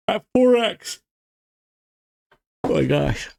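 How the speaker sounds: talking through a yawn; words stretched by a yawn. Real, not acted. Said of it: "I'm sorry for all the yawning".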